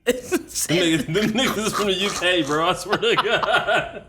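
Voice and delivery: high laughing mock shout; cracking up through the joke; laughing hard. Real, not acted. Joking to his cohost about Baltimore, laughing high. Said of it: "it is not a diss, just how it sounds".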